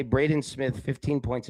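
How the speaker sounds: usual speaking voice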